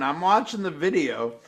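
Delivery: usual speaking voice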